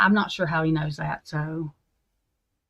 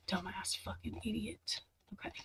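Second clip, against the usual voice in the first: whispered insult; 19 dB quieter than usual. Real, not acted. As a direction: hiss the insult under your breath. Insult muttered after rant.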